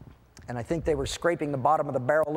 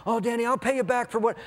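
lightly voiced secretary, pitched up; apologetic secretary; 6 semitones higher than usual. Secretary offering to repay her boss, mildly apologetic. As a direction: plead in a mock higher voice. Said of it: "I'm really sorry".

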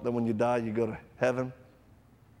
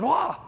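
much higher, louder wah; sudden loud cry; 5 dB louder than usual. Retold or imitated. Dying friend's outburst, sudden loud cry. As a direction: blurt a startling wah. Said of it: "And then".

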